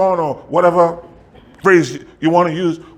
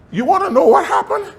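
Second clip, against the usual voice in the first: high, rising teaser; excited tease. Real, not acted. Pastor teasing the congregation before the reveal, high and excited. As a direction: build the suspense.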